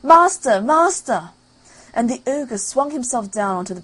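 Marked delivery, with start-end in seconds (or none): 0.0-1.3 high, loud alarmed cry; harp's loud cry for help. Acted. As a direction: call for your master in panic. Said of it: "the harp cried out".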